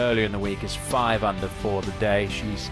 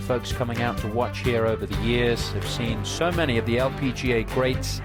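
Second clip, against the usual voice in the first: upbeat broadcast opener, higher pitch; golf commentator; 4 semitones higher than usual. Acted. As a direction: welcome viewers like a TV golf host. Opens the broadcast over music.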